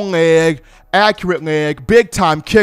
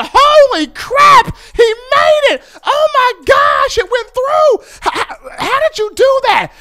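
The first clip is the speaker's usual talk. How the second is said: ecstatic fans screaming; 11 semitones higher than usual. Retold or imitated. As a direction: scream with stadium joy. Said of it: "everybody in Bryant-Denny just loses".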